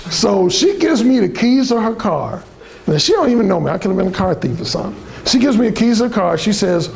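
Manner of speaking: usual speaking voice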